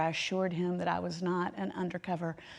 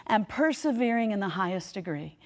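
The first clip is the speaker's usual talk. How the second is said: quote reading; 5 dB louder than usual. Acted. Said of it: "he puts it this way".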